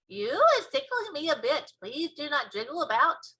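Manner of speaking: ticklish giant. Acted. BFG complains of tickling.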